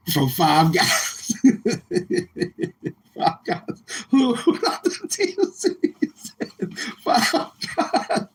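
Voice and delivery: words squeezed out through high laughter; can't stop laughing; cracking up while saying it; laughing hard. Real, not acted. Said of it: "I'm laughing now".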